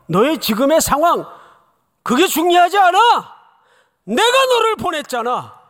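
fervent preaching climax; impassioned preaching. Acted. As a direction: drive the point home with full force. Preaching at full force.

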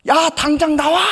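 very high, loud scolding shout; scolding yell. Retold or imitated. Older brother scolding his junior on the phone, loud angry shout. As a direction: order him out right now. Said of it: "제가 혼을 냈습니다".

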